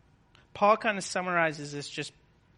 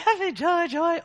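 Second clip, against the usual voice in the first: mock cheer; 10 semitones higher, 3 dB louder than usual. Mocking overly cheerful Christians.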